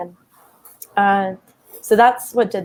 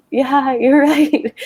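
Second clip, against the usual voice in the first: slow, high, louder, laughing through it; laughing agreement; 7 semitones higher, 6 dB louder, much slower than usual. Retold or imitated. To her yoga mentor, slow laughing admission, pitched up and louder. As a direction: give in with a laugh, half crying. Admitting the mentor was right.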